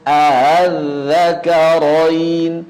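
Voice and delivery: "al-zakarain" begun a-hal, second hamzah softened; tashil. Shown as a demonstration. Teacher showing the second way, hamzah eased by tashil. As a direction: glide through two A sounds into "al-zakarain", slow and level. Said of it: "Hamzah wasalnya ditashil".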